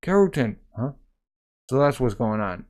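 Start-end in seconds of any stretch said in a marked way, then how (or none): 0.0-0.6 high, loud call across the field. Acted. Soldier calling a comrade on the battlefield, loud call. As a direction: shout his name to get attention.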